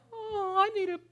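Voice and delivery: high, soft, tiny whimpery voice; hammed-up pitiful little kitty; pleading kitten. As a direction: beg like a lonely kitten. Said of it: "that cat looking up at 'em like".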